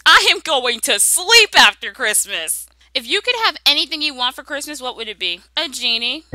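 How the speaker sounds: ordinary talk, nothing marked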